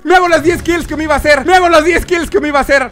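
high, loud hyped-up gamer; real excitement. Real, not acted. Gamer chasing ten kills, loud excitement. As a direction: whoop as the kills rack up.

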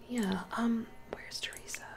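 scared little whisper. Real, not acted. Vlogger whispering about missing sim, nervous. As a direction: whisper it so nobody hears.

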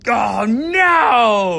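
high startled cry; surprised dismay. Real, not acted. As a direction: react to the bad roll. Priority roll goes badly.

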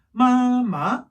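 first "마" high level, second "마" rising. Shown as a demonstration. Teacher showing the pattern, "마, 마" level then rising. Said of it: "이런 톤이에요".

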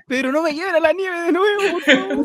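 very high laughing plea; mock pleading. Friend mock begging Pedro not to be taken again, high and laughing. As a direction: beg in a squeaky voice through laughter.

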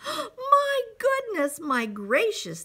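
high exclamation of wonder; astonished shouting. A narrator's reading. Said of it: "they shouted".

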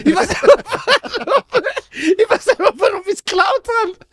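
high mock-anguished confession, breaking into laughter; baffled wail. Friend confessing he stole without reason, baffled wail. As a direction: wail it like a helpless culprit.

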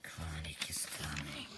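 slow, breathy ghostly whisper; whispered warning. Retold or imitated. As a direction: hush it like a secret warning. Spooky warning retold in story.

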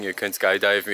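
usual speaking voice

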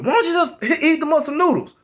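high-pitched, loud, exasperated demand; retold screaming. Woman demanding he cook for the kids, retold screaming. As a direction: yell it like a fed-up woman. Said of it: "she's screaming".

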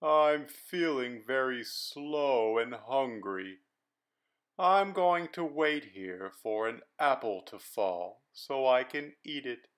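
a hungry lazy worm, dragging each word. Acted. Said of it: "responded the worm".

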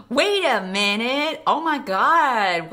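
slow, stunned disbelief; drawn-out shock at a twist. Real, not acted. Reacts to plot twist.

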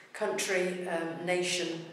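ordinary talk, nothing marked

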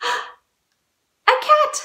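high, loud gasped reveal; gasp, then announce it high; pretend surprise. Teacher revealing who's at the door, mock surprise.

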